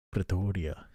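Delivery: put-on South African accent with rising ends. Streamer playfully posing as a Pretoria local, South African accent. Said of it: "It's my South African accent".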